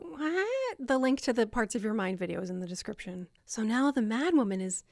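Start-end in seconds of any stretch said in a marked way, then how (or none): 0.1-0.5 baffled. Acted. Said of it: "making you go".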